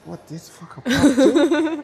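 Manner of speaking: high, slow, loud mock disbelief; slow incredulous crowd voice; amazed crowd. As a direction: play the stunned crowd member. Crowd reaction quoted.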